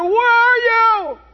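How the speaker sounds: loud, high, drawn-out shout; imitated anguished yell at heaven; angry crying out. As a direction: wail it up to God in acted anger. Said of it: "crying out to God and they're angry at God".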